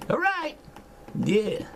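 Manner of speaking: sudden high squeak on All right; high-pitched. Shown as a demonstration. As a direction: jump way up in pitch.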